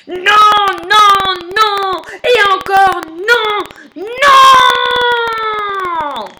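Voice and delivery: volley of high, loud no's, ending in a long roar; roaring refusal. Acted. Lion rejects all the letters, roaring. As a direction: roar each no, stretching the last. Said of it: "rugit le lion".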